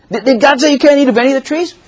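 high, fast, honeyed serpent voice; innocent-sounding question. Retold or imitated. Serpent baiting Eve with an innocent-sounding question, high and quick. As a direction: bait her with a sweet innocent question. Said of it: "Satan's little innocent-sounding question".

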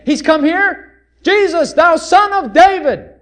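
high, loud, slow plea; excited loud plea; crying out. Acted. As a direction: call out to Jesus in his city. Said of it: "and so he said".

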